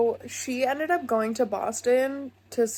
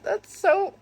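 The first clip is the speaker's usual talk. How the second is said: excited gush; 11 semitones higher, 5 dB louder than usual. Real, not acted. Gushing over the characters.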